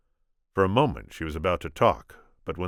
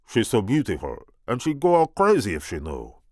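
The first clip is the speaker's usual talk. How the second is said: put-on booming French accent; 7 semitones higher, 7 dB louder than usual. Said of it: "booming French accent of Andre the Giant".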